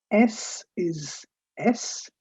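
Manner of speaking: ordinary talk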